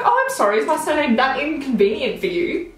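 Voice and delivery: high, mock-apologetic retort; sarcastic apology. She answers the rude post office clerk, sarcastic. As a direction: start high with fake apology, easing down.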